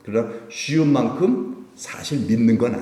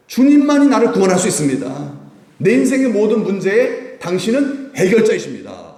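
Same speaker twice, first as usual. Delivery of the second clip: high, loud proclamation; fervent preaching; 10 semitones higher, 7 dB louder than usual. Acted. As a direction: cry out the confession to the congregation. Preaching confession at full force.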